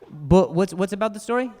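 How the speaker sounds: ordinary talk, nothing marked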